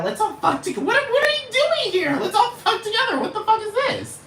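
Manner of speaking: very high, silly falsetto imitation; mock squeal. Acting out someone's bright idea, high mocking squeal.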